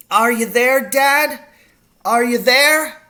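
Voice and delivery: high, loud desperate call; shouting. A narrator's reading. Boy calls into the silent wood for his father, shouting. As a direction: shout to be heard far off. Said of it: "I shouted".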